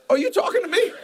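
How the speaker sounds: unsure who he's addressing, laughing; laughing confused question. Retold or imitated. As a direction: pitch up in amused confusion. Confused by cross-eyed preacher.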